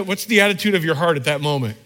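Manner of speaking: ordinary delivery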